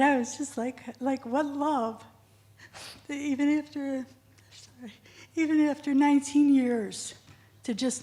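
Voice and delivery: choked up. Real, not acted. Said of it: "Sorry, this is emotional".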